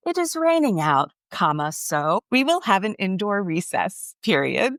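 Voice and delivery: put-on a third grader copying a British accent, reading punctuation aloud; British accent, proud child; kid's British accent. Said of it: "in his British accent".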